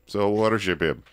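clumsy put-on German accent that drifts into Russian; bad German accent. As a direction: fake a German herald with a failing German accent. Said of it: "I can't do a German accent".

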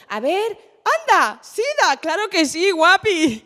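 punchy, sing-song mock approval; mock breezy enthusiasm. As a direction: act out a blithely cheerful organiser. Acts organisers picking her topic.

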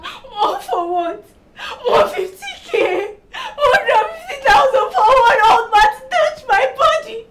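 high, wailing outrage; crying. Acted. Daughter protests to mother about the money, wailing. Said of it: "Stop crying".

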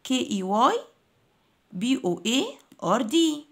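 letters grouped, a pause between groups; spelled in chunks. Shown as a demonstration. Teacher shows pupils how to split keyboard, chunked spelling. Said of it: "زي ما أنا مقسمة الكلمات".

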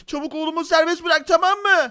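hammed-up urgent threat; mock angry demand. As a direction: order a kidnapper around, playing it up. Father confronts the kidnappers.

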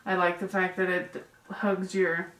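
muffled behind cloth mask; through a face mask. Real, not acted. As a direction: mouth covered by mask.

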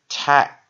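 "tack" loud, with a short vowel; short vowel demo. Shown as a demonstration. Teacher showing the class a short vowel, "tack" said loudly and crisply. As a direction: punch out "tack" as one closed syllable. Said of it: "它是朝下的一个short vowel".

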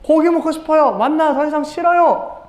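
high-pitched mock whining; complaining. Israelites sick of manna and wanting meat, high whiny complaint. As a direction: act out a fed-up protest. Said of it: "불평을 하고 있어요".

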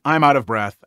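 ordinary talk, nothing marked

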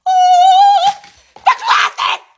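high loud dramatic cry; Edna Mode exclamation. Acted. Edna Mode remembers her glasses, loud dramatic cry. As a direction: gasp it grandly.

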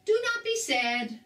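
high-pitched, emphatic puppet-like character; Grover voice. A narrator's reading. Grover the Finder consoles Harry, high character voice. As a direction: pipe up high to cheer him.

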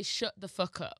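Leicester accent, short "oo" vowel in SHUT. Shown as a demonstration. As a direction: demonstrate a Leicester accent, "fuck" as "fook". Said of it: "you got my accent, which is Leicester".

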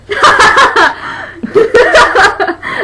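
laughing. Real, not acted. Long laugh at joke.

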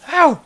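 high, very loud "ow"; pained yell. Real, not acted. Gamer hit by a creature, high loud yell. Hit while playing the game.

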